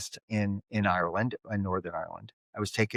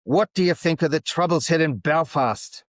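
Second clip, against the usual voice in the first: higher, demanding mock-Irish voice; angry Irish drunk; 6 semitones higher, 4 dB louder than usual. Angry drunk repeats his question to American visitor, mock-angry Irish accent. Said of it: "He was an angry drunk".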